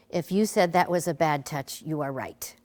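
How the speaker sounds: usual speaking voice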